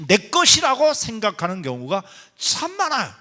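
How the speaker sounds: loud, high, insistent sermon delivery; impassioned preaching. Real, not acted. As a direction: drive each phrase home to the congregation. Preaches forcefully to the congregation.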